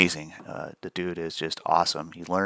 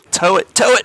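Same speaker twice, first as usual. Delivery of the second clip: excited; 13 semitones higher, 11 dB louder than usual. Real, not acted. Cheering trade on.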